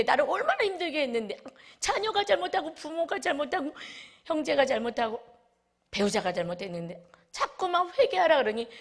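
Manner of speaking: self-pitying complaint. Retold or imitated. Preacher mimics a resentful believer, indignant whine. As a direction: play the wronged one who refuses to repent.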